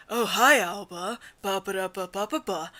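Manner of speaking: low, quiet character voice; Kojiku voice. Acted. Showing co-reader Kojiku's voice, low and soft. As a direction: drop it low and soft, trailing into nonsense syllables. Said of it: "Kojiku would be like".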